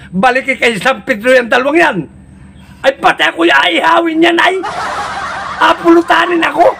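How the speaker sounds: high-pitched upset Satan; exasperated Satan. Acted. Satan orders them sent back.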